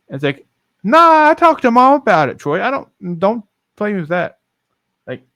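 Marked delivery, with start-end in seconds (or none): 0.8-4.4 high-pitched, emphatic brush-off; imitated sister. Sister tells brother not to play, high and insistent. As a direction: start high and loud, then ease down. Acted back-and-forth with sister.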